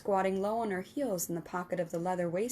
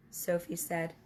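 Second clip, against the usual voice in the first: ordinary delivery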